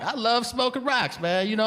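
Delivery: imitating the driver, high-pitched gushing; hammed-up high-pitched enthusiasm; enthusiastic driver. Driver raving to his passenger while driving, high-pitched enthusiasm. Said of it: "this is while he's driving, so it's actually like".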